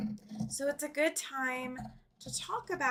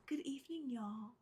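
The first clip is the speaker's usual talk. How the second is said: playful Southern-accented greeting, much softer; 11 dB quieter than usual. Shown as a demonstration. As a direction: say it the Southern way, quietly. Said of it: "say it the Southern way".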